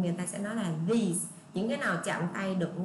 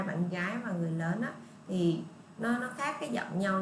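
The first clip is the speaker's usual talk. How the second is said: ordinary talk, nothing marked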